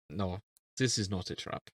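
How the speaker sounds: put-on quiet, understated character accent; Medic impression. Medic waking up in a trap, lightly accented and dismissive. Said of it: "medic waking up and be like".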